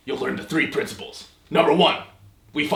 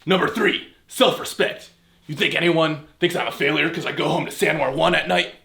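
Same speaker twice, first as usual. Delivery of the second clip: usual speaking voice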